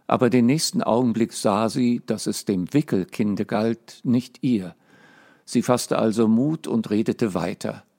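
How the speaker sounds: ordinary delivery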